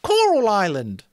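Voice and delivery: high, loud eager shouts; children shouting with excitement; excited clamor. Acted. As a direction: boys calling out book titles over each other. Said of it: "At once there was a clamor".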